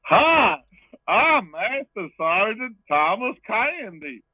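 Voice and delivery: high, loud, drawn-out hammy announcement; Arnold Schwarzenegger. Acted. Host re-enacting the promo for laughs, loud hammy character voice. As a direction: belt it out slowly in a high put-on voice. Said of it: "Arnold Schwarzenegger's face go".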